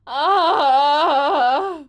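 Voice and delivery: loud, sustained nightmare cry; startled yell. Acted. Patient jerked awake from nightmare, acted yell.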